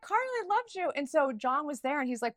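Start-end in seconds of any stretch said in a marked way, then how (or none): 0.0-0.9 high, loud, giddy fangirl squeal. Retold or imitated. Telling her ex about her hero, giddy fangirl squeal. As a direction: squeal it like a starstruck fan. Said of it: "fangirling like crazy".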